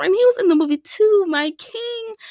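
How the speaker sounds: high, excited gush; excited fangirl. Real, not acted. Fan gushing about [name], high and excited. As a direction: squeal with fan delight.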